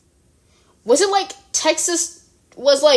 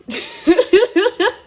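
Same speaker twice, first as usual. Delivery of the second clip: a higher burst of genuine laughter; real high laugh; 8 semitones higher than usual. Real, not acted. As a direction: crack up at the screen. Laughing at bouncing states.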